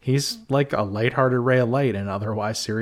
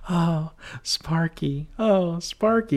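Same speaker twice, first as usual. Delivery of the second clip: high, slow, teasing coo; mock flirty woman; 7 semitones higher, slower than usual. Griffin teasing Benson in the car, high mock coo. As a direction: coo it like a sweet wife teasing her partner. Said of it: "he's in character".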